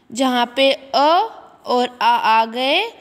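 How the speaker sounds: ordinary delivery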